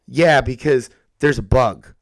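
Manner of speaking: ordinary delivery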